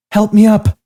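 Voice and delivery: high, loud cry for help. Acted. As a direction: call out as you struggle up. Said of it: "Cassie cried".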